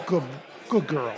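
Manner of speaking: understated praise for a dog, without gushing; no baby talk. Shown as a demonstration. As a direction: praise the dog as a trainer would, restrained. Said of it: "Don't talk baby talk".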